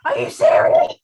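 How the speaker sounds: high, loud, cracking disbelief; mock tearful outrage. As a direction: squeak it out as if near tears. Retold reaction to gecko playing dead.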